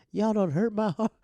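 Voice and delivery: high, loud hurt mom voice; mom's lament. Retold or imitated. Mom watching kids bat her biscuits, high loud hurt wail. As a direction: wail it like a wounded mother. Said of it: "my mom was just like".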